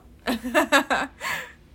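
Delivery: high, loud burst of laughter. Real, not acted. Podcaster laughing after claiming she is hot, loud laugh. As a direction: crack up at your own joke. Laughing at her own boast.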